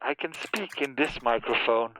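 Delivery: put-on through an old telephone mic; phone voice. Shown as a demonstration. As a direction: sound like you're on the phone. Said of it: "Like this".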